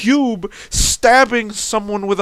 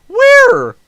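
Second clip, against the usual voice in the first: very high, loud, incredulous; shrieking question; 7 dB louder than usual. Real, not acted. Ranting about the burial place, high shrieking question. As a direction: shriek it in disbelief.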